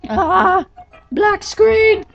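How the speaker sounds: high, loud yelp of surprise; startled shriek. Real, not acted.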